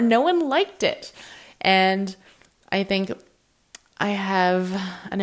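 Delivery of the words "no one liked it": high mock whine; sarcastic. Mock whining that nobody liked her post. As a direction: pout it out, half joking. Sarcastic reaction to low views.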